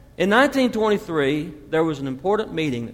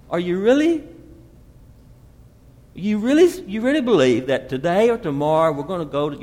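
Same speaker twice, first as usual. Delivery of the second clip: ordinary talk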